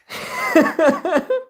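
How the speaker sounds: squeaky burst of laughter; high-pitched laughing fit. Real, not acted. Friend laughing at the joke.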